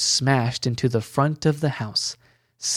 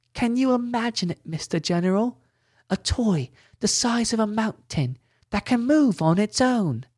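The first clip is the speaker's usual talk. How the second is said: put-on much higher, wide-eyed boy voice; excited child; 10 semitones higher than usual. A narrator's reading. Billy telling Glint about the toy, excited. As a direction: marvel at it like a kid. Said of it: "Billy almost sounded excited".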